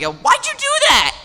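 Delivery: very high, loud protest; indignant outcry. Shown as a demonstration. Mia after being flicked, high indignant squawk. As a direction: squawk in outrage at being hurt. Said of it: "it's just like".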